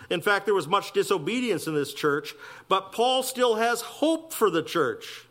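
fervent sermon climax; preaching. Acted. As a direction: lift the congregation with conviction. Preacher exhorts at full force.

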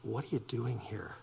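soft tender question; gentle, soft voice. Acted. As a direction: ask it as quietly as a comforting God. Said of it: "in a gentle, soft voice".

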